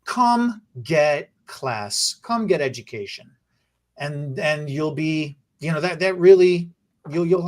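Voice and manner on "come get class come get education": slow invitation. Real, not acted. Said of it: "invitation to viewers".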